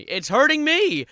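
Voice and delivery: loud high wail of pain; anguished outburst. Real, not acted. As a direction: cry out like it really hurts.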